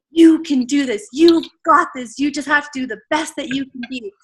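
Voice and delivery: high, forceful coach's pep talk; in-your-face motivator. Retold or imitated. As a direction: imitate a trainer urging right into the screen. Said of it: "right up in my face".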